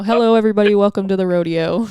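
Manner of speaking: loud booming announcer welcome; rodeo announcer voice. Retold or imitated. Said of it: "give us your best rodeo announcer voice".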